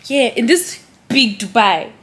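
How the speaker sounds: ordinary talk, nothing marked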